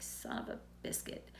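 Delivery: near-whispered, deflated mild oath; stunned under-the-breath curse. Retold or imitated. Patient hears the doctor confirm bad news, stunned near-whisper. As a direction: let it out softly, almost no voice.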